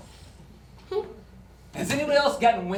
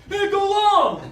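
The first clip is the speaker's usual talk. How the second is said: calling out; 12 semitones higher, 7 dB louder, slower than usual. Acted. Acted call to go long.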